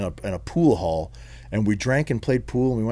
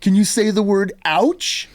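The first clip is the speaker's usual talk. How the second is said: condescending American director, imitated; berating director; 9 semitones higher, slower than usual. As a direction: drill the word slowly, in someone's face. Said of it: "got in my face and was like".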